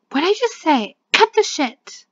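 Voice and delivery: loud, high-pitched scolding; acted furious yell; angry shout. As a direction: blow up at them for not listening. Game character scolds the others.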